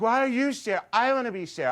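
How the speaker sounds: put-on high, loud childish protest; whining sister. Sister arguing over who plays Cher, shrieky indignation. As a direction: imitate an indignant little sister.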